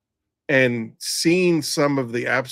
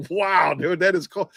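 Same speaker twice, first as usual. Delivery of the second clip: imitating a partner's amazed reaction, higher, with a laugh; high-pitched mock astonishment; amused disbelief; 5 semitones higher than usual. Retelling partner's amused shock at cold joke.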